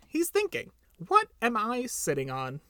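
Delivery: high voice, starting very high; little bird. A narrator's reading. Little bird guesses what Pooh is thinking, high piping voice. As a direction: pipe it up like a small bird.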